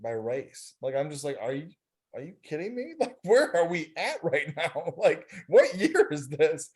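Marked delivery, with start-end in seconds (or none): 1.4-6.7 retelling a shock with a laugh breaking through; are you kidding me, half laughing; incredulous laughing disbelief. Retold or imitated. Telling colleagues about the segregated qualifier room, disbelieving with laughter.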